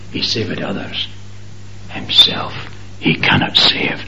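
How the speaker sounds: whispered throughout, soft and low; whispering the crowd's jeer at the cross; whispered taunt. Acted. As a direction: breathe the mockers' words. Mockers' taunt repeated.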